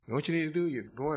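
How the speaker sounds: ordinary talk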